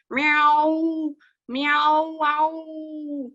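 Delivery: long, pitiful meowing; drawn-out, high cat yowl; pitiful tomcat squall. A narrator's reading. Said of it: "It was a squalling and meowing".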